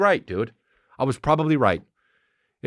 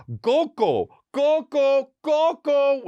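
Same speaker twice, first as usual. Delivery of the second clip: screaming toddler imitation; much slower than usual. Toddler yells at the TV remote, high mock screaming. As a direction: imitate a toddler shrieking one word over and over. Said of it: "screaming in the Apple remote".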